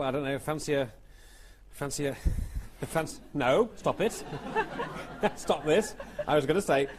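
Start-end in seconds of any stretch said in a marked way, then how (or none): 3.8-6.9 laughing. Real, not acted. Host cracks up at his pun.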